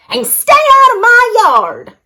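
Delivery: high, loud grumpy yell. A narrator's reading. Hank yelling at Rosie to stay out of his yard. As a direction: order her off the yard. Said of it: "Hank yelled back".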